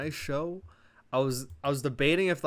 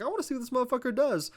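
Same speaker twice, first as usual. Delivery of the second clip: higher, rushed mocking tone; fast mock high voice; 10 semitones higher, faster than usual. Vlogger mocking The Rock, high and fast. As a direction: rattle it off in a silly high voice. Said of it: "The Rock's like".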